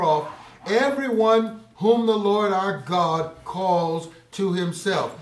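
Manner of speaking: loud, high, driving proclamation; fiery pulpit voice; preaching at full force. Acted. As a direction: call it out to the whole congregation. Preaches Peter's sermon aloud.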